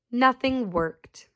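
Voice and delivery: plain reading voice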